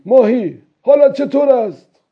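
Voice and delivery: put-on high, loud old fisherman's call; calling out loudly. A narrator's reading. Old man asking the fish how it is, calling loudly. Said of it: "پیرمرد با صدای بلند پرسید".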